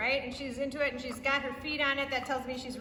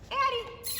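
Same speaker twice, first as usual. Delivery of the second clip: calling out; 9 semitones higher than usual. Real, not acted. Calling dog's name.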